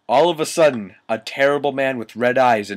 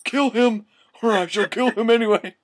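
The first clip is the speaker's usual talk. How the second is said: high, strained villain rasp; evil raspy voice; 12 semitones higher than usual. Acted. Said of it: "said evilly in his raspy voice".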